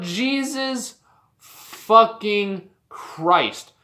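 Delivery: very slow, word by word; exasperated oath. Real, not acted. Fan fed up with the booking, exasperated slow swearing. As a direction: drag each word out with long pauses.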